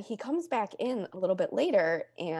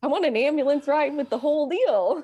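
excited; 9 semitones higher, 7 dB louder than usual. Real, not acted. Excited laughing retelling.

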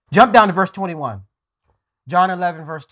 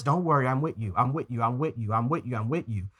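soft, soothing reassurance, fading softer; calming voice of God; 5 semitones lower, 7 dB quieter than usual. Acted. God calming his screaming, crying people, soft and soothing. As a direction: hush a frightened child, gently. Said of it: "he's calming us".

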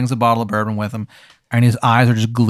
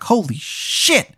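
put-on drawn-out, higher Baltimore accent; terrible Baltimore accent; 9 semitones higher, much slower than usual. Wizard of Baltimore looking up at the game, bad Baltimore accent. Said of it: "in his terrible accent".

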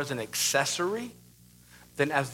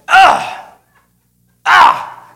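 loud, high strained grunt; frustrated grunting; 16 dB louder than usual. Retold or imitated. Preacher mimicking his daughter in the pantry, frustrated grunts. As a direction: imitate a child's frustrated grunting, loudly. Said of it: "like, grunting".

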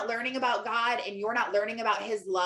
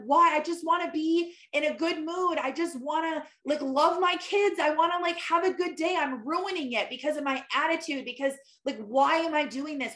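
higher pleading, exasperated with herself; frustrated self-plea; 5 semitones higher than usual. Retold or imitated. Mom retelling her past plea alone in her room, higher and exasperated. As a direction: ask yourself, fed up and desperate. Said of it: "be like".

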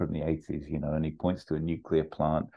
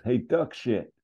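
high, raised voice calling out; heckling barman; 10 semitones higher than usual. Acted. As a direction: yell it across the bar. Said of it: "the barman just goes".

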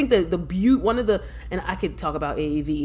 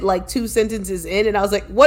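mock panic; 3 dB louder than usual. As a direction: act out helpless panic, voice raised. Retold panic at own slipping accent.

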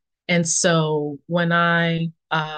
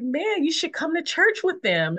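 put-on inviting young woman; 10 semitones higher than usual. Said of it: "And so she was like".

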